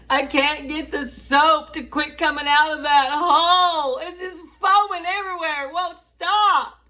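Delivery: mock hysteria over the foam; retold panic. Telling mom the soap won't stop, in mock panic.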